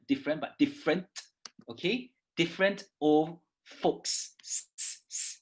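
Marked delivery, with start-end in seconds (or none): 2.4-4.3 Different with a sharp T, said slowly; careful slow Different old folks; crisp T sounds, slow. Shown as a demonstration. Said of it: "look at the T sounds here". 4.4-5.4 long unvoiced sss; s s s hissed. Shown as a demonstration. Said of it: "Pronounce your S".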